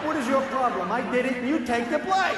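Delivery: high, exasperated know-it-all cat; scornful cat. Acted. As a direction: scold the dog like a cocky cat. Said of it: "the cat is hanging out in the living room going".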